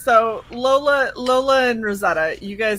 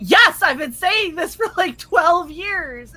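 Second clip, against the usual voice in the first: higher, slow exasperated yes; 8 semitones higher, slower than usual. Retold or imitated. Patient fed up after years of complaints, exasperated and slow. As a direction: drag out the years in disbelief. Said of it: "And I went".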